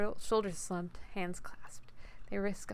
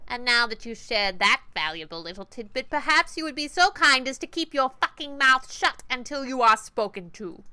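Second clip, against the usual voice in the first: sweet menace; 5 semitones higher, 9 dB louder than usual. Acted. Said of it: "Sweet, nasal, and grinding".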